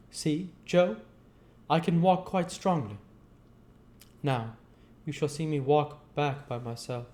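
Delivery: put-on eager young man proving his strength; young Pip voice. Pip speaks to Joe.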